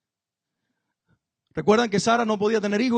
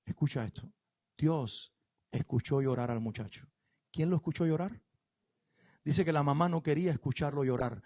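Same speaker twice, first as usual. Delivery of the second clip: soft, hushed storytelling; narración íntima y suave; 5 semitones lower, 6 dB quieter than usual. Acted. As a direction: draw them close with a soft tender tale. Relato íntimo muy suave. (relato bíblico suave e íntimo)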